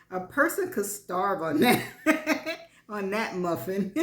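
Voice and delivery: words broken up by laughter; giggling while she mocks him; laughing through it. A narrator's reading. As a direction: laugh at his so-called muffin top. Laughing at Stan's claim.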